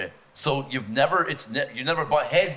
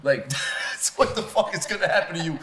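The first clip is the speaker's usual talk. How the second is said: ordinary delivery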